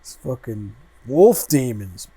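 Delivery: usual speaking voice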